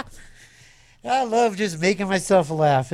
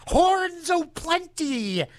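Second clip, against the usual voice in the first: high, slow sing-song; hammed up; 10 semitones higher, much slower than usual. DJ hyping horns in a high, slow sing-song. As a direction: stretch it out like a carnival barker.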